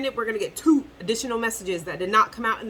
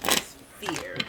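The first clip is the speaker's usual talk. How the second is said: whisper; 7 semitones lower, 7 dB quieter than usual. Shown as a demonstration. Whispered answer to own question.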